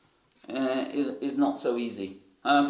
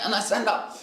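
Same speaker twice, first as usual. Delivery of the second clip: ordinary talk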